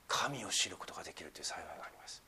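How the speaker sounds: hushed whisper of a blessing. Real, not acted. Pastor whispering a blessing to the congregation. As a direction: breathe it almost silently. Softly voicing scripture blessing.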